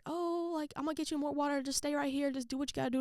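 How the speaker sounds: lightly voiced dad, up high and easygoing; dad's casual reassurance. Dad telling sick daughter to stay put, casual reassurance. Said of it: "my dad's just like".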